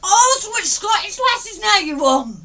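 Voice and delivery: loud, high indignant teasing; jealous outburst. Real, not acted. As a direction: call him out at high volume.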